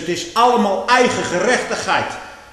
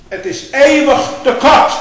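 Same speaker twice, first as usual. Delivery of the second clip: loud, slow, raised pulpit cry; preaching at full force; 9 semitones higher, 11 dB louder than usual. Acted. Preacher condemning false shepherds, loud and slow. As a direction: drive it home from the pulpit.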